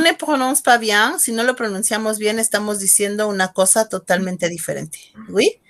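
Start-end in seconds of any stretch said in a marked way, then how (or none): none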